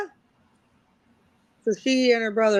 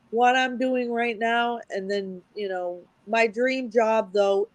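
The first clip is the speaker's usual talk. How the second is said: ordinary talk, nothing marked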